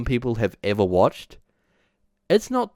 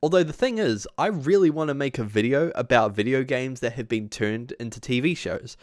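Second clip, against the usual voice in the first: usual speaking voice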